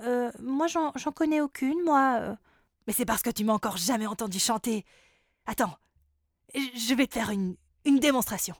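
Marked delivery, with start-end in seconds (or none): none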